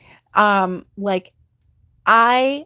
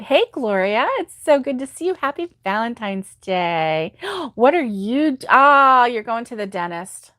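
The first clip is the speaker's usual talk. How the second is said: ordinary talk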